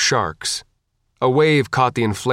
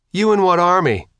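mocking repeat; 9 semitones higher, 4 dB louder than usual. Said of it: "Faith repeated".

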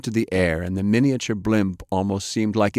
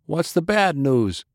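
higher, slow wisecrack; joking; 8 semitones higher than usual. A narrator's reading. Young pilot jokes with the captain, wry. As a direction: toss it off as a grim joke. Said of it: "Barnes asked jokingly".

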